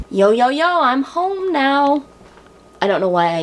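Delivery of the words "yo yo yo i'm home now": higher, drawn-out "yo" greeting; hammed-up singsong arrival, dragging the words out; goofy hype greeting. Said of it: "don't let me do that again".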